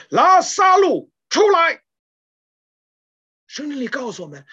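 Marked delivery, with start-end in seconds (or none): 0.1-1.8 a loud, slow, high call into the tomb; loud command. Retold or imitated. Jesus calling Lazarus from the tomb, loud slow command. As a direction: call the dead man out with full force. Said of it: "主耶稣大声地喊道".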